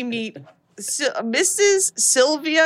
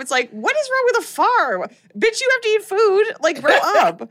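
exasperated rant; 9 semitones higher than usual. Real, not acted. Ranting at the snobby mother.

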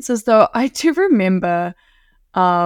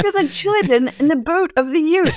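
excited; 9 semitones higher than usual. Retold or imitated. Retold excited call, repeated.

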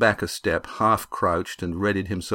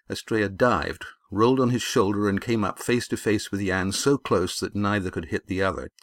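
plain reading voice

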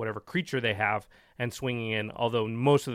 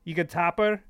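higher mock Scottish accent reading the card; 7 semitones higher, 6 dB louder than usual. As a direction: keep reading in a hammed-up Scottish voice. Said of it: "not your accent".

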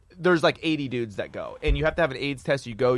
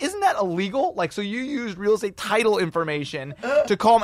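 mock indignant, pitched up; accusing; 7 semitones higher than usual. Comedian accuses real estate agent, mildly outraged. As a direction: imitate a suspicious interrogation.

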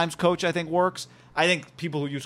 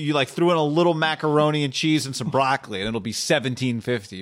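normal talking voice

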